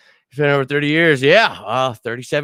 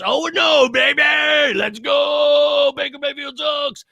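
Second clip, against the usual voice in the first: higher, hyped-up yelling at the rival; hyped shout; 12 semitones higher than usual. Real, not acted. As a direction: pump up the fans like a rally cry. Hyping up rivalry week.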